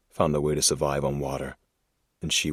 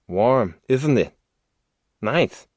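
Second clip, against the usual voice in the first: creepy man voice; 8 semitones higher, 6 dB louder, slower than usual. Acted. Man lowers her into water.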